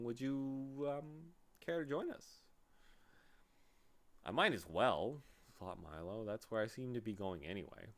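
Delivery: usual speaking voice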